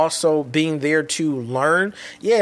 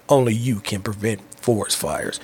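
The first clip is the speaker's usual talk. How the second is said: deep mascot voice, much lower; Smokey the Bear; 7 semitones lower than usual. Retold or imitated. Speaker recalling the voice heard in his head, low bear impression. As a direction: drop low for a bear's safety slogan. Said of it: "We came up on Smokey the Bear".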